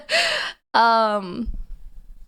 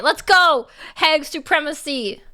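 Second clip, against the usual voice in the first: excited cheer; 5 semitones higher, 3 dB louder than usual. Real, not acted. Cheering being a hag.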